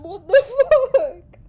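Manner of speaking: loud tearful laugh mid-curse; real teary laughter; laughing and crying. Real, not acted. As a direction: curse through a laughing sob. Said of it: "laughing and crying at the same time".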